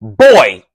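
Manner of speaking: put-on "Boy" leaned on low and loud; parent's demeaning "Boy" from the early seventeen hundreds; mocking boy. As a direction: drop low and hit "Boy" like an old slave master. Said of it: "back in, like, the early seventeen hundreds".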